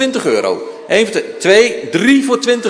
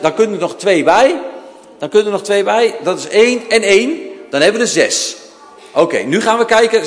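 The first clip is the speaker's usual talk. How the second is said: usual speaking voice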